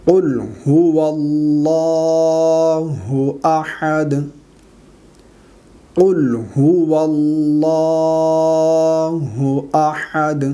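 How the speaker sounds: long drawn-out chanted recitation; slow Quran recitation. Shown as a demonstration. Teacher recites the surah for students, slow and chanted. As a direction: recite slowly, stretching every vowel.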